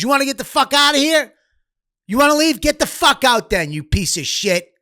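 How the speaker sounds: high-pitched, berating rant; angry dare, imitated. Imitating the old guy answering a bitching kid, angry dare. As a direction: act out an old man throwing someone out.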